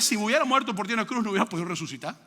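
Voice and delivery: normal talking voice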